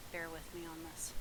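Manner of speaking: soft unvoiced plea; whispering. Real, not acted. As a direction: whisper an apology to viewers. Pleads while eating.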